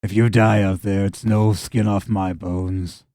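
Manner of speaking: low, slow, subdued farmer voice; resigned farmer, trailing down slowly; simple farmer, indifferent. Acted. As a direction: wash your hands of them wearily.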